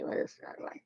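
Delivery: ordinary talk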